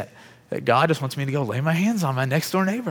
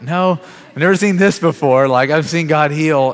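higher, louder, slowing on the last line; excited exclamation; 4 semitones higher, 7 dB louder than usual. Retold or imitated. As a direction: insist with wide-eyed wonder. Answering astonished brother-in-law.

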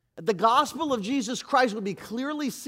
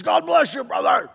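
loud, high, raspy wrestler shout of "God bless you, brother"; Macho Man Randy Savage; 5 semitones higher, 7 dB louder than usual. Retold or imitated. Preacher imitating Macho Man Randy Savage for the congregation, shouted. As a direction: bellow it like a wrestling promo. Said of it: "Macho Man Randy Savage".

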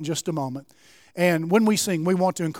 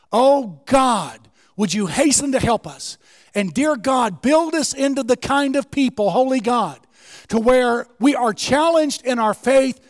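rising, louder pleading to God; fervent preaching prayer; 4 semitones higher, 3 dB louder than usual. Acted. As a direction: cry out to God, building.

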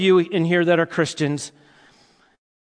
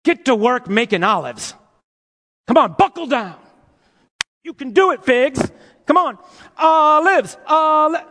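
mock pep-talk coach; 5 semitones higher than usual. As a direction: cheer the tree on like a coach, half joking. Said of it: "We would never look at a fig tree and say".

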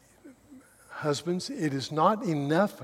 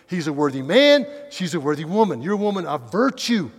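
loud, ringing sermon emphasis; full-force preaching; 3 semitones higher, 10 dB louder than usual. Acted. Preacher extolling Ruth's virtue to congregation, loud and fervent. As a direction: proclaim each line to the back pew.

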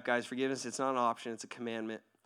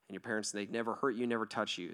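normal talking voice

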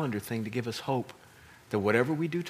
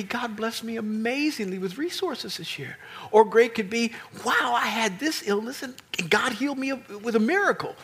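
excited testimony; 7 semitones higher than usual. Retold or imitated. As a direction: voice lifted high in glad testimony. Quoting grateful believers.

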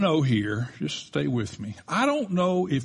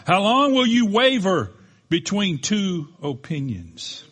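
slow preaching; 4 semitones higher, 4 dB louder than usual. Acted. Preacher quoting prophet's challenge.

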